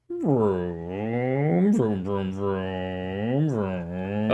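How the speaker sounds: playful engine vroom noises; goofy mouth car sounds; silly clown. Pretend. Outro of intro car noises.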